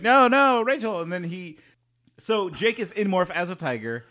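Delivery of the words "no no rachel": pleading no, no, higher and louder; anguished cry. Retold or imitated. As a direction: cry out for a fallen friend. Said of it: "Jake's just like".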